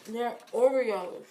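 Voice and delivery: slow, talking through a mouthful of cookie; mouth full. Real, not acted. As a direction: say it while chewing Oreos. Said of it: "Them shit's loud as fuck".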